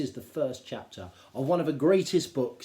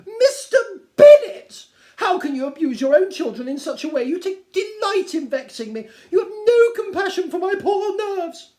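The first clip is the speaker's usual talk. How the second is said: much higher and much louder, wailing; wailing Mrs Bennet; 15 dB louder than usual. Acted. Wife wailing at husband, high and loud. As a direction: cry out about her nerves.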